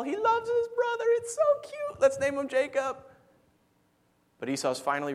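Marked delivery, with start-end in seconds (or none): 0.0-3.0 put-on high cutesy baby talk; much higher, doting baby-talk voice; cooing over a baby. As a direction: coo it like a doting parent.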